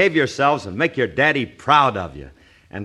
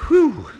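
effort exhale; 10 semitones higher, 4 dB louder than usual. Real, not acted. Straining to close loaded trunk.

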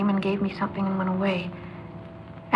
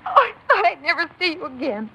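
tearful; 8 semitones higher than usual. Acted. Crying reunion.

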